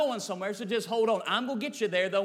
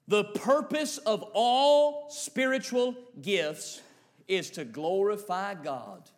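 slow emphasis; slower than usual. Acted.

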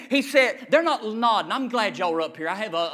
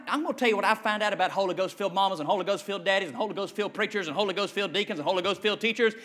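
ordinary talk

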